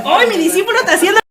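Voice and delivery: quick, proud retold exclamation; mock excitement. Jesus watching his disciple imitate him, excited. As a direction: voice a teacher thrilled by a pupil. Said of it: "Jesús estaba emocionado".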